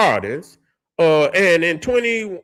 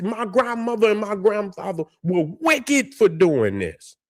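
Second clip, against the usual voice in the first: ordinary talk, nothing marked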